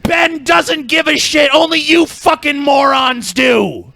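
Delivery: high, stretched-out angry shout; exasperated shouting. Real, not acted. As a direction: yell it slowly at the idiots in the comments. Still ranting at his commenters.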